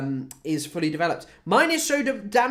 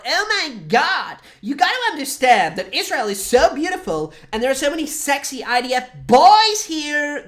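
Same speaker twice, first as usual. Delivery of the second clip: put-on slow, high gushing valley girl voice; mock Miami girl; 8 semitones higher, 5 dB louder than usual. Mocking American visitors to Israel, high gushing drawl. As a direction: gush like a Miami tourist girl in love with Israel. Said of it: "It's actually just like".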